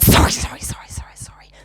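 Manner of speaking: harsh mocking echo, bursting loud then dropping; vicious mockery. Speaker mocking the woman's apologies back at her, vicious mimicry. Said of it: "Vicious, vicious I was".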